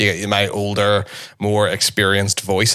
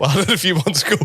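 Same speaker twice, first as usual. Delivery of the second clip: high-pitched, tickled repeat; amused echo; 8 semitones higher, much faster than usual. Retold or imitated. Brother teasing about the forgotten fortieth birthday, high amused echo. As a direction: echo the answer in amused disbelief.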